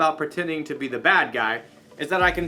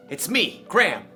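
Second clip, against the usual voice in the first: ordinary delivery, nothing marked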